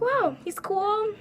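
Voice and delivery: high, slow impressed wow; high drawn-out gush. Retold or imitated. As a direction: swoon a little over him. Impressed by his Instagram.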